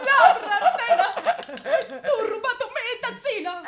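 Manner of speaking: mock-outraged laughing yell; laughing accusation. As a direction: shout it while laughing. Accusing her of stealing the cup.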